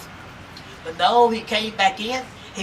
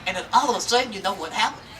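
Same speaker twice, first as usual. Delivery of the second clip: usual speaking voice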